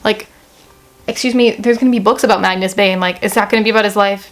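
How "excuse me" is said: ordinary delivery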